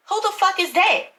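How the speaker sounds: sudden high alarmed question; startled. Acted. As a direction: jump at the knock and demand who it is. Startled by a knock.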